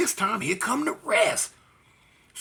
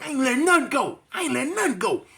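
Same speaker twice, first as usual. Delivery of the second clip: defiant refusal; 7 semitones higher than usual. Retold or imitated. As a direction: refuse to let go, dead set.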